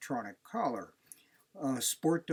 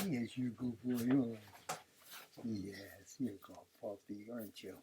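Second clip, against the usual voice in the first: cooing to dog; 3 semitones lower, 8 dB quieter than usual. Real, not acted.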